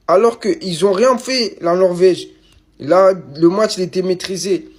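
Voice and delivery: plus fort et plus aigu, lent; s'emporter, voix qui monte; loud outburst. Real, not acted. Frustrated rant about the defeat. (éclat de frustration, plus fort et plus aigu)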